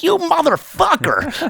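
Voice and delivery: very high, loud, drawn-out curse; blurted outburst. Retold or imitated. Passenger cursing his driver in front of the cop, blurted out loud. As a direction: let it burst out uncontrollably. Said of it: "I couldn't help it. I spit it out, like, out loud".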